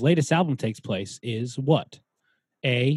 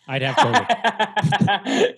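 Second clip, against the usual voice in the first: laughing; 7 semitones higher, much slower than usual. Real, not acted. Joking, laughing mid-line.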